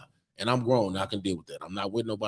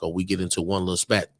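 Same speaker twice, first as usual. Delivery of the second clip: normal talking voice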